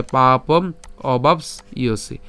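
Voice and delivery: পাপম অবাপস ইয়সি slowly, in split syllables. Shown as a demonstration. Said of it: "তাহলে".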